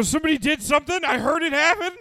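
loud, high, frantic report; exaggerated high-pitched tattling; mock alarmed shout. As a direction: yell it out from the stall. Yelling to newcomer from stall, retold.

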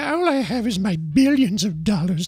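high put-on voice of a rich lonely author; mock Rowling impression, high and affected. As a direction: lampoon her in a high, precious voice. Impersonating J K Rowling mockingly.

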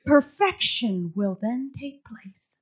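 slow, breathless whisper; hushed whisper. Retold or imitated. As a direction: let the promise fall to a whisper. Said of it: "where he said".

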